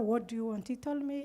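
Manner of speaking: normal talking voice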